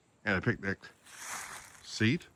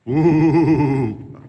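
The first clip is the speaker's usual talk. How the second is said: drawn-out spooky ghost moan; ghostly ooh; 8 dB louder than usual. Pretend. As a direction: moan like a haunted tunnel ghost. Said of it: "I'll enter my own sound effects".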